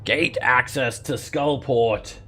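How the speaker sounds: slow, high booming magical announcement; a disembodied voice fills the room; unearthly voice. A narrator's reading. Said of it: "a magical disembodied voice fills the room and says".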